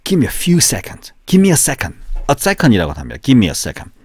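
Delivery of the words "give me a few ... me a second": urgent plea. Acted. Acted urgent request.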